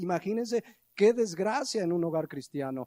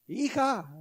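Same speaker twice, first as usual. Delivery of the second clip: calling her name, pitched up; calling daughter; 7 semitones higher than usual. Retold or imitated. Father calling his daughter the first time, a raised call. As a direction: call the daughter once from across the house. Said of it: "Y más fuerte".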